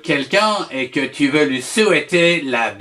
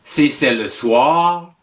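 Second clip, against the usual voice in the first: usual speaking voice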